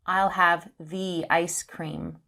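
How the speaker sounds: THE as "thee" before ice, slowly; slow sentence, THE with a long ee. Shown as a demonstration. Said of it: "the with a long sound".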